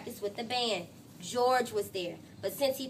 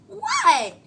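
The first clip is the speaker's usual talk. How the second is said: defensive what; 7 semitones higher, 12 dB louder than usual. Acted. Francine's defensive retort.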